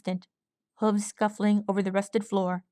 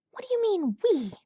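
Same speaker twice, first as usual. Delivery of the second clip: high, disbelieving question; incredulous filly; 11 semitones higher than usual. A narrator's reading. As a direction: push back on the word we. Crochet incredulous.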